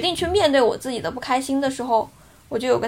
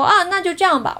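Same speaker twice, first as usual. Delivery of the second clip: imitating his angry reply, higher pitched; retold angry reply; 6 semitones higher, 4 dB louder than usual. Friend ending the phone call with her, raised and irritated. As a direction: hang up on her mid-argument, with a mock angry snap. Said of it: "他当时就很生气".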